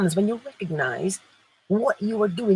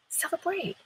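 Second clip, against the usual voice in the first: soft high echo; 9 semitones higher, 9 dB quieter than usual. Real, not acted.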